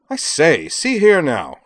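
high, loud comic-opera upper-class English protest; Lord Peter Wimsey. A narrator's reading. Student rises to object to professor, in comic English lord's accent. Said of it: "his comic opera Lord Peter Wimsey accent".

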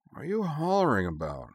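half-awake grumbling question; bleary. A narrator's reading. Woman woken by shouting asks her partner, blearily. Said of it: "blinking blearily in the gloom".

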